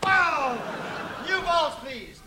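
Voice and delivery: sharp line-judge cry of "Foul!"; umpire call. Acted.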